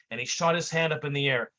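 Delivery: ordinary delivery, nothing marked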